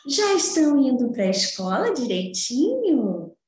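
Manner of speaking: put-on high, sing-song greeting for little kids; sweet children's-host welcome; bright squeaky sing-song welcome. Greeting children at lesson start.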